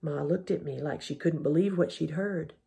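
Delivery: ordinary delivery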